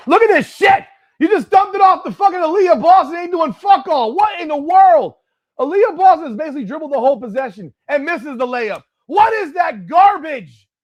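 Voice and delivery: yelling high and loud at the screen; furious rant. Real, not acted. Fan yelling at the game broadcast, furious and loud. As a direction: scream your outrage at the play.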